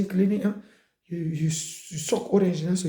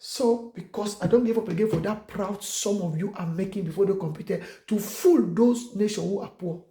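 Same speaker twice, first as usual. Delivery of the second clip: ordinary delivery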